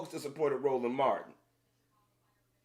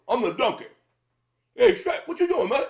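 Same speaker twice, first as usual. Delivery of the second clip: loud, much higher cartoon donkey voice; 8 semitones higher, 9 dB louder than usual. Vlogger imitating Eddie Murphy's donkey, loud high cartoon voice. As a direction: belt it out like the Shrek donkey. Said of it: "he talked like this".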